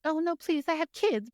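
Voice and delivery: high-pitched frightened begging; pleading cook. Acted. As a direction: plead in a high, mock-scared voice. Voicing a cook begging under interrogation.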